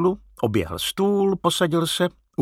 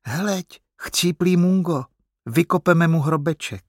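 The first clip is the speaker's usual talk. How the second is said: put-on high-pitched little boy voice; small boy calling out, pitched up; 6 semitones higher than usual. A narrator's reading. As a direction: pipe up like a young boy. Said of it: "jak nějaký chlapeček říká".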